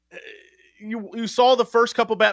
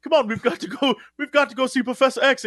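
lightly put-on accent, a bit higher; Storm's accent; 6 semitones higher than usual. Storm hurrying the team along, light put-on accent. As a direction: urge them along in a hint of Storm's accent. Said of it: "why Storm has an accent".

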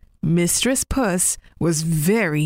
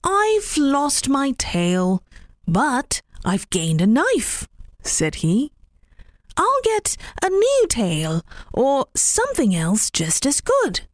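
cheerful rabbit voice; 7 semitones higher than usual. Acted. Rabbit consoling himself after losing his tail, lightly cheerful. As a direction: shrug off the loss brightly.

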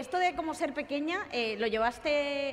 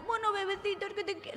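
put-on higher cooing baby voice; baby talk; 6 semitones higher, 6 dB quieter than usual. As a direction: coo like a couple in love. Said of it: "con su pareja habla como bebé".

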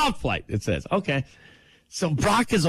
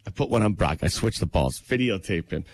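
retold low aside; low confiding aside; 8 semitones lower than usual. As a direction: drop low, as if tipping off the cameraman. Retells confiding in the cameraman.

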